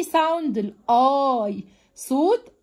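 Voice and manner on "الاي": الاي ending in a long held "eye"; clear long I. Shown as a demonstration. Teacher naming the sound that I gives, slow and held. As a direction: draw out the "eye" of الاي slowly. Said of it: "ظهر صوت واضح فاداني".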